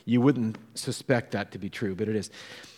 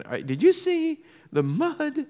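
high, squeaky disbelief; comic falsetto-like alarm; mock incredulity. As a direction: act out an appalled thought, voice shooting up. Acting out his incredulous thought.

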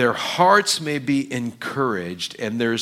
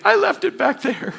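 sheepish reply; 4 dB louder than usual. Retold or imitated. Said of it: "where's your faith".

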